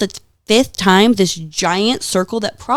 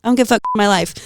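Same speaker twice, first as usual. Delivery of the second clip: ordinary talk, nothing marked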